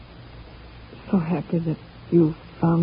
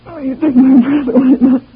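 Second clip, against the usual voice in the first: breathless delight; 7 semitones higher, 12 dB louder than usual. Acted. Breathless after a kiss.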